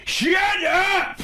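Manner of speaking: very high, loud yell; Sandler yell. Retold or imitated. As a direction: scream it out in a squeaky voice. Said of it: "he yells".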